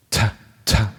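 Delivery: Ta with an aspirated English T; English-style breathy T on Ta; North American T. Shown as a demonstration. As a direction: puff air after the T in Ta. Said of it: "This is how they say it here in North America".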